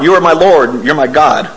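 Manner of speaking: usual speaking voice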